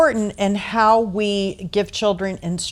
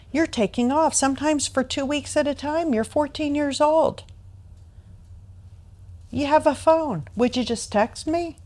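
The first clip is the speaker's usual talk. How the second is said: pleading; 6 semitones higher, 5 dB quieter than usual. Retold or imitated. Mother pleads with her runaway daughter.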